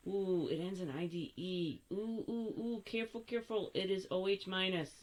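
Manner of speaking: ordinary talk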